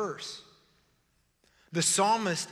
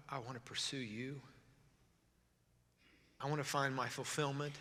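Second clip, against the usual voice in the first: soft, halting prayer with long pauses; desperate prayer; 7 dB quieter than usual. Acted. Preacher praying to God each day, soft desperation. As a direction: plead quietly, fading away at the end. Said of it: "I want to be that desperate for God".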